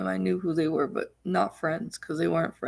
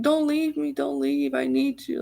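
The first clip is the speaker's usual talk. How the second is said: high-pitched mock pleading; theatrical begging; 6 semitones higher than usual. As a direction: imitate someone begging not to be left. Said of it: "it was quite theatrical and fake".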